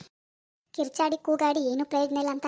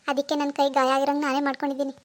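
ordinary talk

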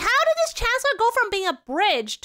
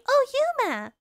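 put-on anime girl call; 7 semitones higher than usual. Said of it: "That's what I remember most".

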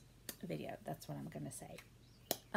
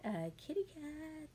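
cutesy kitty voice; 8 semitones higher than usual. Pretend. Cute answer about being cat.

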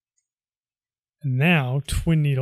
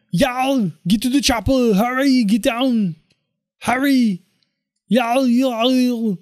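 higher, louder action-hero shout; Arnold Schwarzenegger; 7 semitones higher, 4 dB louder, slower than usual. Retold or imitated. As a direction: bark orders like a movie commando. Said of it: "my best Arnold Schwarzenegger impression".

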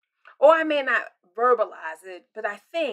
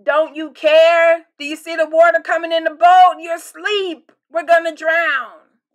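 higher, louder frantic plea; panicked yell; 6 semitones higher, 11 dB louder than usual. Retold or imitated. Disciples waking Jesus in the storm, panicked yell. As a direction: scream over the storm in panic. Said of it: "they're like".

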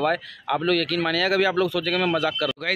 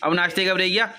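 ordinary talk, nothing marked

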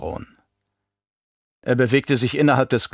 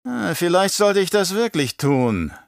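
grübelnd; 8 semitones higher than usual. A narrator's reading. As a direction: stretch it out as if weighing the idea. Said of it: "grübelte der Baron". (langsam und hoch, nachsinnend)

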